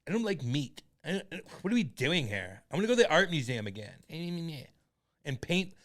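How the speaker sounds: mock a precious, complaining activist; stammering, put-on whine of a protester; whiny activist. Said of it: "They're like".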